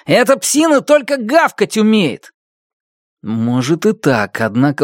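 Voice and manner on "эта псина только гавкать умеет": scoffing. Acted. Boy scoffs at the dog.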